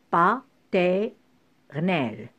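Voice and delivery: "paternel" very slowly, broken into pieces with long pauses; slow teacherly chopping of "paternel"; syllable by syllable. Shown as a demonstration. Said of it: "couper le mot par syllabe".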